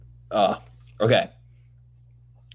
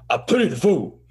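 higher, swooping tough-guy impression; Mister T; 6 semitones higher than usual. Host demonstrates Mister T to co-host, emphatic impression. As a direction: punch out the catchphrase. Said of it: "what about Mister T?".

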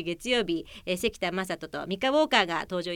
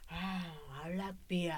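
low, soft, offhand English answer; understated imitation of an English speaker; foreign visitor's reply; 3 semitones lower, 10 dB quieter, much slower than usual. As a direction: answer quietly as the foreign passer-by. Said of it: "って返ってきたから".

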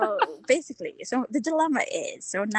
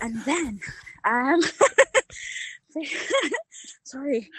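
out of breath; 2 semitones higher, slower than usual. Real, not acted. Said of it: "I'm, like, panting".

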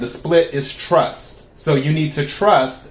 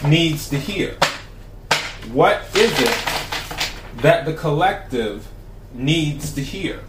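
invocation; slower than usual. Acted. Invokes tarot deck while shuffling.

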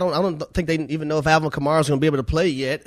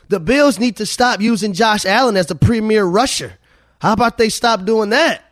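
raised, louder and higher-pitched complaint; exasperated rant; 7 semitones higher, 5 dB louder than usual. Real, not acted. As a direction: push it up high and loud in exasperation. Exasperated plea about team's play-calling.

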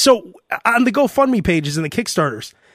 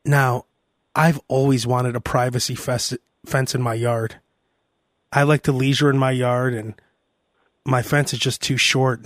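low, soft, earnest-sounding pitch; mock crowdfunding plea; 7 semitones lower, 3 dB quieter than usual. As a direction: plead gently for donations as a joke.

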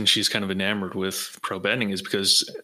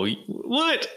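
surprised. Real, not acted. Learns J K Simmons voices Tenzin.

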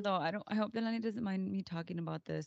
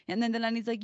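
normal talking voice

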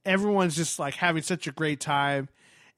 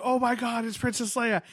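starstruck; 7 semitones higher than usual. Retold or imitated. Retold starstruck reaction.

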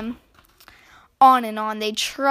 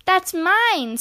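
high, louder squeaky protest; squeaky squirrel; 8 semitones higher, 6 dB louder than usual. A narrator's reading. Squirrel claiming the spoon as its own, squeaky. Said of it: "squeaked the squirrel".

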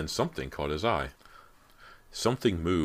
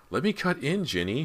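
high-pitched urgent wheedling; pressing, voice pitched right up; insistent; 11 semitones higher than usual. A narrator's reading. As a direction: plead to be let in. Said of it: "she insisted".

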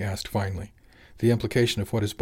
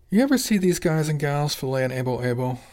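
higher-pitched pressing question; 6 semitones higher, 3 dB louder than usual. A narrator's reading. Jimmy presses Tony about the fish filleters, insistent question. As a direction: press the point, voice lifted. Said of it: "Jimmy pressed".

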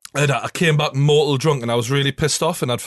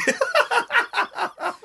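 long, high cackling laugh; real burst of high laughter; high-pitched laugh. Real, not acted. Amused by the body remark.

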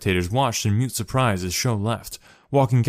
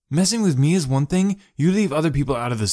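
higher, sharp warning; 6 semitones higher than usual. A narrator's reading. Max warns his sister off his friend, sharply. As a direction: cut in hard to defend a friend. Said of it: "he said sharply".

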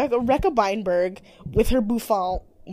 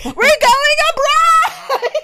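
very high, slow squeal; excited shout; 8 dB louder, much slower than usual. Retold or imitated. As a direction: squeal the good news. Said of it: "and are like".

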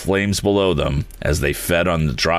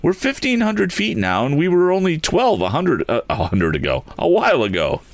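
hopeful; 11 semitones higher than usual. A narrator's reading. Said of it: "spoke Tom hopefully".

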